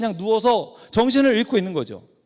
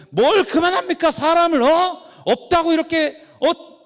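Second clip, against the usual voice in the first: much higher and louder, protesting hard; indignant shout; 9 semitones higher, 5 dB louder than usual. Retold or imitated. Pastor defending the neglected patient to nurses, indignant shout. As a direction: shout back at the nurses who tell you to stop. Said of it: "막 큰 소리를 치니까".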